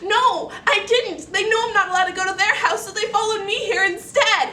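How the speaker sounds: put-on high, loud, rushed pleading girl; desperate protest. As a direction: plead your innocence in a rush.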